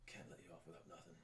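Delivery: very soft, quick character whisper. Acted. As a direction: whisper it low and fast, for one listener only. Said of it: "So he whispers to you".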